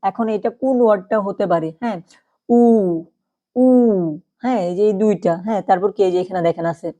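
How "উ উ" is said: "উ" as a long, held vowel; letter-name demo. Shown as a demonstration. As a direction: draw out "উ" as one clear sound. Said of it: "আমি যখন পড়লাম উ".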